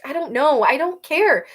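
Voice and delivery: high, loud, fed up; exasperated outburst. Acted. Arrow refusing to stay, loud and exasperated. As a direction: throw up your hands.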